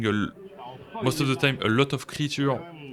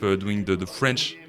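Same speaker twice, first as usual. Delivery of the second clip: normal talking voice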